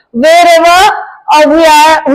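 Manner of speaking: ordinary delivery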